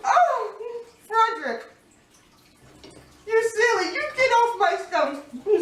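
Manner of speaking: high, laughing scold; startled scolding. Real, not acted. Shooing a spider off her scones, high laughing scold. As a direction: shoo the spider off, half laughing.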